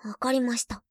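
usual speaking voice

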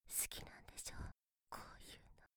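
soft teasing whisper. Acted. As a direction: whisper it close to the ear. Teasing whispers close to the mic.

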